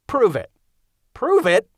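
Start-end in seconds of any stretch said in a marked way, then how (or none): 1.1-1.7 incredulous echo. Acted. Bill echoes in disbelief.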